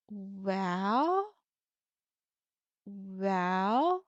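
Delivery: long "well", low then swooping up; hesitant. Shown as a demonstration. Teacher demonstrates third tone as hesitation, drawn-out "well". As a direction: draw out each "well" in doubt, dipping and rising. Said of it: "when we hesitate".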